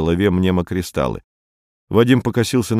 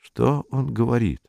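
higher-pitched, grumbled question; grumbling; 4 semitones higher than usual. A narrator's reading. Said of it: "проворчал Саул". (ворчливый вопрос)

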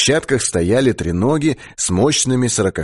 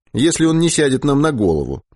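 usual speaking voice

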